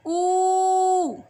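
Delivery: sustained ऊ; long oo sound. Shown as a demonstration. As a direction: stretch the ऊ long. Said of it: "लंबा खींचता है".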